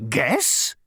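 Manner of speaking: loud, high puzzled machine voice; baffled tank. Acted. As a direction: echo it back, confused. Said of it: "said the tank".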